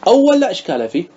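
normal talking voice

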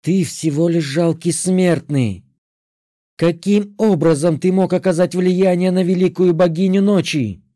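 властное рычание надменной ведьмы; прорычи свысока. A narrator's reading. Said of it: "прорычала ведьма". (надменный рык ведьмы)

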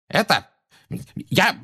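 stammering, high-pitched outrage; too enraged to get the words out; flustered fury. A narrator's reading. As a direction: splutter in bursts, then explode on the last word. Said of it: "взбеленился Фадж".

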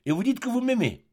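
high, disbelieving question; reproachful challenge. A narrator's reading. Woman doubting the artist's love, in high reproach. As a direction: throw the question at them, pitched far up.

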